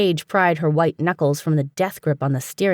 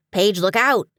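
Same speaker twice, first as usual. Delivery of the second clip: a lightly raised warning; mild mock shout; 4 semitones higher than usual. Dewey warning Paige of the deer, mild shout. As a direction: call out a warning, understated. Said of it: "Dewey shouted".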